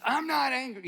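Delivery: loud, high-pitched protest; angry denial. Shown as a demonstration. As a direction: act out snapping that you are not angry. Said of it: "it sounded more like this".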